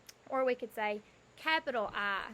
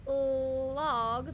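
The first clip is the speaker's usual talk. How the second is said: slow, stretched word; 5 dB louder than usual. Shown as a demonstration. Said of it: "the first sound that we hear".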